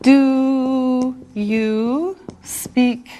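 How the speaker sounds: very slow, each word stretched out; word-by-word teacher demo, very slow; slow modelled question. Shown as a demonstration. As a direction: draw out each word for learners. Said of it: "and how you say it".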